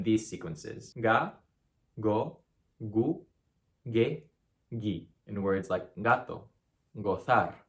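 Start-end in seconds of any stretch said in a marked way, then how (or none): 1.0-5.1 Ga, go, gu, ge, gi each with a hard g. Shown as a demonstration. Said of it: "just like the English G in go".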